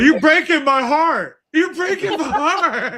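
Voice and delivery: mock heartbroken, drawn out slowly; slow mock-wounded whine; heartbroken plea. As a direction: plead like your heart is breaking, hammed up. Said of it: "He's like".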